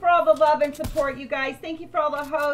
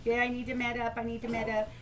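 softer, shaky adrenaline voice; shaking; 6 semitones lower, 12 dB quieter, faster than usual. Real, not acted. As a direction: talk while still shaking. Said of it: "I can't stop shaking right now".

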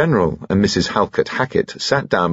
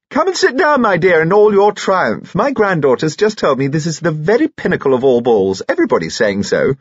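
effusive society lady; 10 semitones higher, 3 dB louder than usual. Acted. Grandmother congratulates hostess on her ball, effusive and high-voiced. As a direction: gush over the hostess in a high aristocratic voice.

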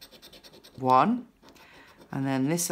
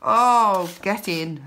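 ordinary talk

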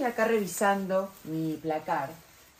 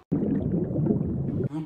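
slow, bored answer; bored drawn out; 5 semitones lower than usual. Acted. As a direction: drag the word out, fed up. Bored mother drags word.

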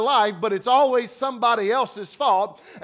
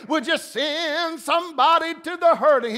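higher, hammed-up tearful plea; mock emotional prayer; 5 semitones higher than usual. Preacher mocking churchgoers, emotional prayer voice played up. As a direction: overact a choked-up prayer. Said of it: "we get emotional with the prayer".

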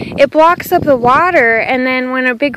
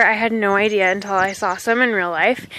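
ordinary delivery

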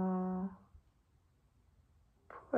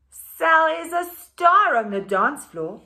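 bright, louder storybook reading; animated picture book read-aloud; reading to children; 9 semitones higher, 10 dB louder than usual. A narrator's reading. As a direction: read it out lively for the class.

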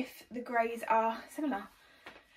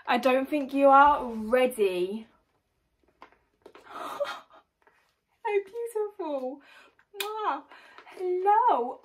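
dog voice; 3 semitones higher, 4 dB louder, slower than usual. Acted. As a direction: coo at it like a puppy. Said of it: "makes me want to do my dog voice".

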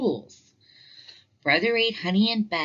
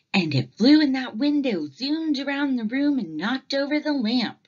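higher, lightly voiced eager cubs; a child's excited fib, pitched up; 7 semitones higher, 4 dB louder than usual. Cubs telling Mama their whopper, eager and high.

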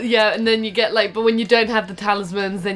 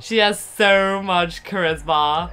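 slow, even robot voice; monotone robot; 3 semitones lower, much slower than usual. Pretend. Robot defending Rosie's charisma, slow monotone robot voice. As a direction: insist slowly on one note. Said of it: "in a monotone voice".

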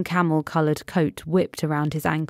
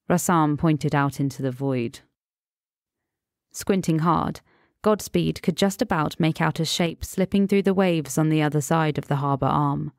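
ordinary delivery, nothing marked

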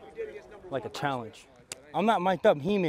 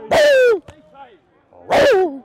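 usual speaking voice

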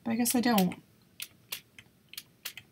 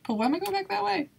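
much higher, fast puzzled question; baffled; 10 semitones higher, much faster than usual. Real, not acted. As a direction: rush it out, bewildered. Baffled at own movement.

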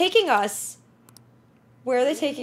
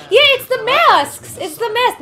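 high, loud, drawn-out realization; alarmed outcry; 8 semitones higher, 6 dB louder than usual. Real, not acted. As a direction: cry out in horrified recognition. Alarm at masked knights.

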